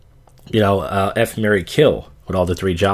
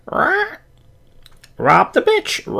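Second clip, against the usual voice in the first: squawking parrot voice; 3 dB louder, much slower than usual. Pretend. Mock parrot voice in news.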